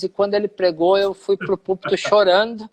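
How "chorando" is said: ordinary delivery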